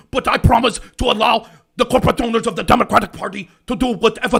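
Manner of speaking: a half-hearted Brooklyn Bernie voice, declaiming; Bernie accent. Retold or imitated. Bernie apologizes to party owners, light Brooklyn Bernie accent. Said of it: "I'm up for a Bernie accent".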